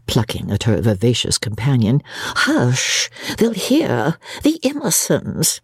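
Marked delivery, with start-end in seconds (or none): 2.1-5.6 high, urgent shushing; anxious, high-pitched hush. A narrator's reading. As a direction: warn a friend that others may overhear. Companion afraid of being overheard.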